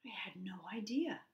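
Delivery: whispered apology. A narrator's reading. Bluebird apologises to Duck and Goose in a whisper. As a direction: breathe it softly, sorry.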